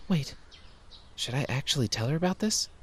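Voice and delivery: usual speaking voice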